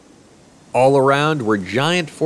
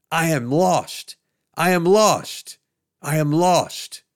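mild shout, higher and drawn out; slow shout; 5 semitones higher, slower than usual. Acted. Found man calling to his rescuers, mild slow shout. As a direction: call it out, slow and lifted.

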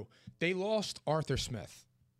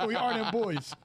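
hyped; 7 semitones higher, slower than usual. Pretend. Hyped Cowboys chant.

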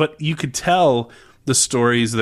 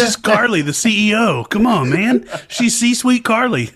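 higher and louder, mock cheerleading; mock hype; 11 semitones higher, 6 dB louder than usual. Mocking the sermon's success story, loud high mock hype. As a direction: hype her up like a game-show host.

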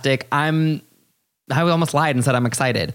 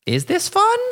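mock puzzled. Voiced reaction quote.

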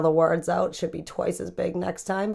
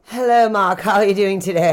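higher, louder put-on British accent greeting; a weak British accent, pitched up and loud; 4 semitones higher, 8 dB louder than usual. Co-host greeting Mark on the podcast in a weak British accent. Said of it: "you busted out the English".

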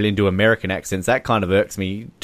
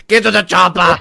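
loud, higher shouted Austrian bark; Arnold Schwarzenegger impression; 9 semitones higher, 7 dB louder than usual. Host shouts an Arnold line for a joke edit. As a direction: yell it like an action hero. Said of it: "Arnold Schwarzenegger quotes".

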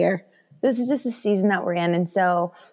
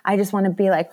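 normal talking voice

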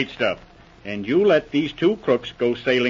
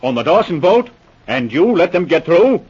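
indignant outburst; 5 semitones higher, 6 dB louder than usual. Acted. Inspector learns crooks slipped by.

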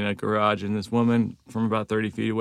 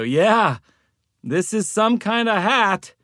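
unsure guess; 10 semitones higher, much slower than usual. Retold or imitated. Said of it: "and I go".

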